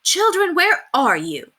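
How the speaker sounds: put-on high, loud calls after lost children; frantic calling. A narrator's reading. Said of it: "frantically calling".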